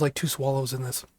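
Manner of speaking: ordinary talk, nothing marked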